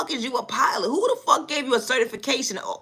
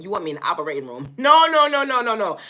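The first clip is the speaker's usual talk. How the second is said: normal talking voice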